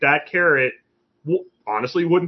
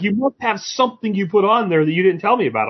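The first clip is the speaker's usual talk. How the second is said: mock suspicious friend; 8 semitones higher than usual. Retold friend accusing.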